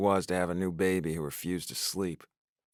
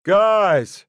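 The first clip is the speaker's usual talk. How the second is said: high loud yell; 11 semitones higher, 12 dB louder than usual. A narrator's reading. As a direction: shout it into the house. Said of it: "he yelled again".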